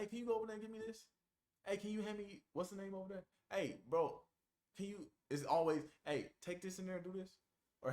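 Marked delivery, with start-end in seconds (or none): 0.0-5.1 lightly tossed-off request, higher pitched; casual asking tone. Shown as a demonstration. Someone asking you to do stuff, casual wheedling request. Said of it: "That's the tone I'm using". 6.0-7.4 offhand request, higher pitched; casual asking tone. Shown as a demonstration. Someone passing you an errand, casual asking tone. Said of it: "That's the tone I'm using".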